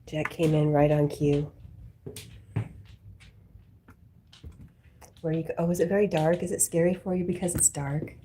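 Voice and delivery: loud, fond chatter to the dog; loud talk to dog. Real, not acted. Owner talking loudly to her dog Jack, fond and loud. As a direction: call over to the dog at full volume.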